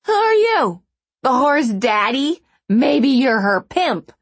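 high, loud sneering taunt. A narrator's reading. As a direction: sneer it in contempt. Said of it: "the woman sneered".

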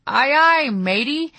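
high, loud hearty pirate call. Retold or imitated. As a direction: ham it up like a pirate. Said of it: "in his best pirate voice".

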